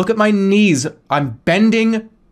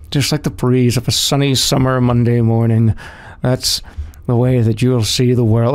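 very low, close-up hypnotic murmur; mock sleep meditation; 9 semitones lower than usual. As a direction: parody a soothing meditation guide right at the ear. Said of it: "go right up to your fucking ear".

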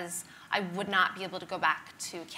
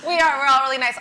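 mock proud; 5 semitones higher, 11 dB louder, faster than usual. Real, not acted. Playfully owning Canadian niceness.

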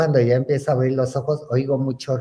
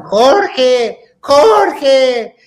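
high, loud long calls of a name; distant calling; 11 semitones higher, 8 dB louder than usual. Retold or imitated. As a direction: call out as if searching far away. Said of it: "oía que gritaban".

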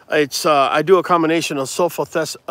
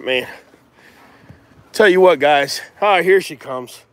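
normal talking voice

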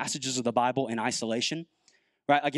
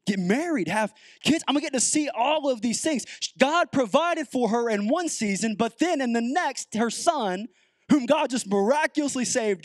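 higher, louder impassioned preaching; preaching at full force; 10 semitones higher, 3 dB louder than usual. Acted. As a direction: drive the story home to the congregation. Preaches with rising intensity.

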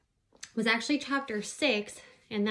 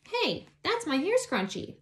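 surprised; 9 semitones higher than usual. Acted. Miranda spots her scrunchie.

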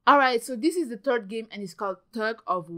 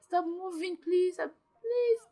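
anxious pleading; 6 semitones higher, 5 dB louder than usual. Real, not acted. Pleading with Sim.

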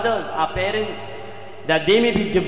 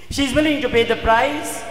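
raised, ringing preacher's voice; forceful restatement; emphatic declaration; 7 semitones higher, 5 dB louder than usual. Shown as a demonstration. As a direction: proclaim it high and loud. Repeats the statement emphatically.

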